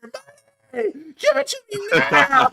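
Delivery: high, hammed-up falsetto demand; screaming robber. Imagining the co-host robbing someone, in a mock screaming voice. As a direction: shriek it as a silly robber. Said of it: "you doing that voice".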